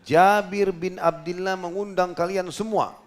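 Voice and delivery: loud slow call with laughter; shouting out. Retold or imitated. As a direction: proclaim it to the crowd, laughing. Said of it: "lalu beliau teriak".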